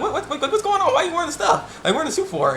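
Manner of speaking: high, squeaky mock panic with a laugh; mock shocked boyfriend. Laughing, high-pitched mock disbelief. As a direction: ham up the boyfriend's shock at his girlfriend in a suit.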